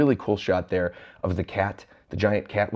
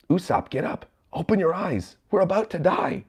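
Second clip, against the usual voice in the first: high, urgent pleading without loudness; high-pitched frightened character voice; acted panic; 8 semitones higher than usual. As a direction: plead in rising alarm. Voices Nami panicking at lion.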